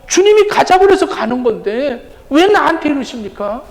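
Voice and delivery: high, pleading protest to God; resentful complaint. Acted. Aggrieved believer asks God why me, resentful complaint. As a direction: plead indignantly, pitch pushed up. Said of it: "이러면서 어떤 불평, 원망".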